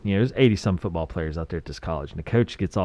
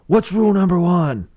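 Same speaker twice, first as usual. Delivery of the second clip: imitated bullhorn yell, much higher and louder; coach yelling through a bullhorn; 11 semitones higher, 5 dB louder than usual. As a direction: shout the question at the whole team. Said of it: "screaming at him through a bullhorn".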